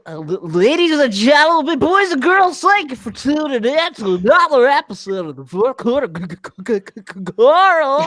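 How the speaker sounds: high, stretched-out showman's welcome, stuttering at the end; put-on show-opener voice. Host playfully opening the podcast for listeners, hammed-up announcer voice. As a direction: ham up the ladies-and-gentlemen intro with stammered syllables. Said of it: "Don't do that goddamn voice".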